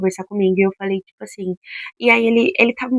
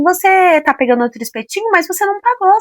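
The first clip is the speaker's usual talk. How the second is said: higher, louder mock telling-off; imitated accusation, pitched up; confronting; 9 semitones higher, 4 dB louder than usual. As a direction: act out an indignant woman calling someone out. Acts out the woman confronting him.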